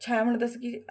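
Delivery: normal talking voice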